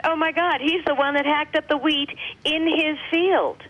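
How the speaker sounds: sudden penny-drop astonishment; retold realization. Realizing the farmer hacked up the wheat, amazed. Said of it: "I went".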